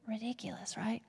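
breathy stage whisper of disbelief; whispered aside. Real, not acted. Preacher whispering to the congregation about the disciples' request. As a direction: lean in and whisper it like a secret joke.

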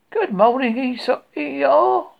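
sunny Pooh good morning; cheerful bear. Acted. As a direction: call out the hello like an eager small bear. Cheerful bear greets donkey.

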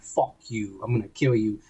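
low, soft menacing growl; growled threat. Retold or imitated. Master Chief glaring at Halsey, low menacing growl. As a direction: mutter it low like a death threat. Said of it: "he looks at her like".

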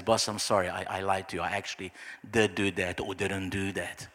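low, soft apology to the boss; sheepish confession. Acted.

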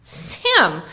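loud, astonished exclamation; amazed cry. A narrator's reading. Frodo seeing Sam is the spy, amazed cry. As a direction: cry the name out in sheer amazement. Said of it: "cried Frodo, feeling that amazement could go no further".

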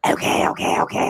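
raspy robotic okays; imitating distorted voice. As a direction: rasp out the okays like a glitchy mic. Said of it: "You were just like".

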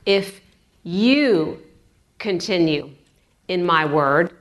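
"you" drawn out between pauses; stress on you. Shown as a demonstration. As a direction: isolate and lean on "you", then drop away. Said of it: "put an emphasis on all three words".

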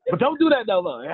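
high, half-laughing grandma voice; grandma warning. Retold or imitated.

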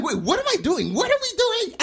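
indignant questions climbing very high; livid retelling. Retold or imitated. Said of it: "I get livid".

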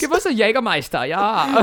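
high, eager German party voice; mock German accent. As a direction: demand it in silly German accent. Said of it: "the crew was mostly German".

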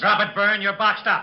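loud, high order to drop the gun; commanding shout. Acted. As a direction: bark it at the gunman from cover. Police lieutenant bursts in on gunman.

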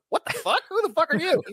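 mock-shocked, high-pitched double question; startled outburst. Film character sees the wrong Batman, high startled disbelief. Acted startled reaction to seeing Clooney.

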